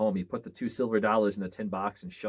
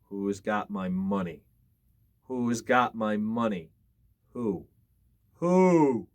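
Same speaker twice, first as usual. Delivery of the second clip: slow, moaning ghost call; a hint of a far-off ghost; distant ghost voice; slower than usual. A narrator's reading. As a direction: call from far off like a lost spirit. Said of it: "a voice crying".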